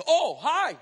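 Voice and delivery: loud, high, swooping hello; jump, then greet too eagerly; startled greeting. Acted. Man looking up at huge woman, startled greeting.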